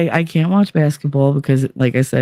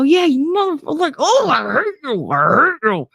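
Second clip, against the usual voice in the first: much higher, furious yelling, then settling; heckling the screen; 12 semitones higher than usual. Retold or imitated. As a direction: yell hatred at the wrestler on TV. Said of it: "I go".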